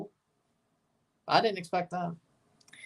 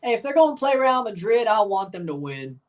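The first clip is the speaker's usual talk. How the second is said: ordinary talk, nothing marked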